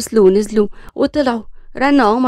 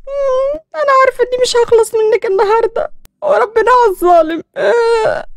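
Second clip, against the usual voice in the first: much higher, put-on whining voice; whiny mocking curse; 12 semitones higher, 3 dB louder than usual. Daughter grumbling a curse at her mother, high comic whine.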